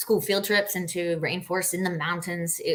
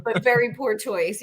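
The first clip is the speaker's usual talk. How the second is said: high, loud laughing yeah; laughing agreement; 6 semitones higher, 7 dB louder than usual. Real, not acted. Agreeing her outfit was a flex, laughing.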